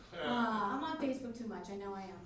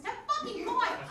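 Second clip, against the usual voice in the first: drawn-out, high, loud outburst; outraged exclamation; 12 semitones higher, 6 dB louder, slower than usual. Acted. Mother correcting her gamer son, outraged yell. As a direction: yell it slowly in mock outrage.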